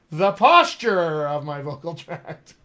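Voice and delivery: "posture" slow and loud, drawn out; stress on posture. Real, not acted. Coach reveals the key term after "wait for it", "posture" stretched out loud.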